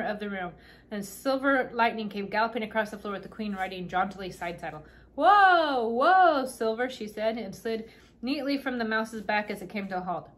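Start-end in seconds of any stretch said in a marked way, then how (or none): 5.1-6.9 loud, high, drawn-out whoas, easing on the name; calling whoa. A narrator's reading. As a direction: rein in a galloping mount. Queen reining in her galloping mouse.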